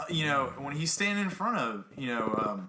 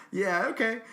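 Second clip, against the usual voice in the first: a higher, light laugh into a breezy yeah, okay; 8 semitones higher than usual. Retold or imitated. Brushing off the rival coach's jab, laughing it off. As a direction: shrug off the insult with a laugh. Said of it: "So I laugh, I blow it off".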